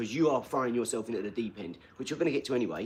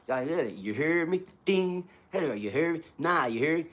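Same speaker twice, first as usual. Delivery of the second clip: imitated American street catchphrase; American accent; 5 semitones higher, 4 dB louder than usual. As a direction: throw out a mock American challenge. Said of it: "the two bloods from America".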